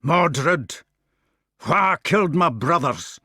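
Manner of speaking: loud Scots demand; filling the room. Acted. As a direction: make it fill the room. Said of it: "Gawain's voice suddenly filled the room".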